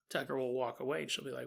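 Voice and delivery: ordinary delivery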